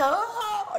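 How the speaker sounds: high-pitched mock yell, drawn out; high, contained pretend scream; imitated scream. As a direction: let out a demonstrative "aah" cry. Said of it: "Você grita".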